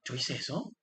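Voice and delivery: soft, startled "¿Yo hice eso?"; surprised dismay. Retold or imitated. Teacher voicing someone shocked at their own outburst, softly surprised. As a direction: caught off guard by your own outburst. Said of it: "no dice".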